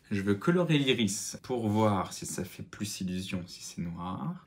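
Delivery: ordinary talk, nothing marked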